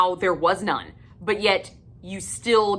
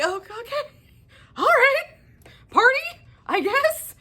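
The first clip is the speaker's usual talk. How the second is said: very high, put-on shrug of a voice; mock resigned squeak. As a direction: squeak out a stunned okay. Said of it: "I was like".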